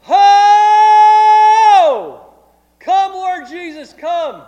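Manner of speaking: high, loud cry, slow on the plea; fervent shouted prayer. Retold or imitated. Pastor imitates intercessors across the hall, shouting high and loud. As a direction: belt it out like someone caught up in prayer. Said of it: "here's what I could hear".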